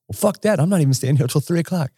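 high-pitched mock outrage; retold rant, high but not shouted; imitated going off. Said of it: "literally going off on the Delta people".

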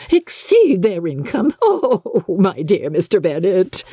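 high, excitable mother; a fussing, delighted mother; Mrs Bennet, giddy. Acted. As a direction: gush over the match. Mrs Bennet scoffs at husband.

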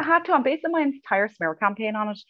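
high, mocking Derry accent. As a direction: squeak out a mock Derry schoolgirl accent. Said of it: "my terrible accent".

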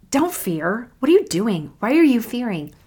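imitating an exasperated telling-off, pitch lifted; mock reproach, pressing each question; disappointed scolding. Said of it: "a guy who's really disappointed".

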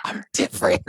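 whispered breathy voice. Pretend. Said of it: "whispery, breathy voice".

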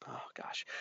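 unvoiced whispered oh gosh. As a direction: breathe it out like a secret. Retold inner panic, whispered.

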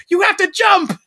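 high, loud shouted order; urgent shout. Retold or imitated. Blonde girl at the overflowing river, high urgent shout. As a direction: yell it over the storm. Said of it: "the little blonde girl's like".

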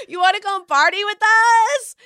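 high, loud party holler; mock party shout. Partygoers yelling at the roommate, loud high mock holler. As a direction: yell it across the room, hammed-up. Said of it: "party goers are like".

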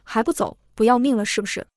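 ordinary delivery, nothing marked

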